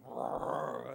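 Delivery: loud, long rolling lion roar; granddad lion's roar. Acted. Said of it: "his granddad's roar".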